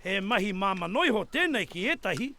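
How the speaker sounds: steady Māori voiceover; documentary narration. A narrator's reading. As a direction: narrate it like a TV presenter.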